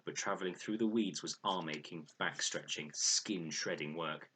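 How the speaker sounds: usual speaking voice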